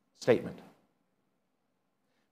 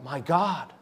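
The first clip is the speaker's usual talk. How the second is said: mock cry out; 9 semitones higher, 6 dB louder than usual. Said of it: "he'll cry out".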